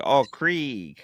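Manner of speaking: Krieg with a long ee, mocking; mimicking mispronunciation. Mocking the drive-through worker's Krieg. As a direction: imitate the wrong Krieg. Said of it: "They go".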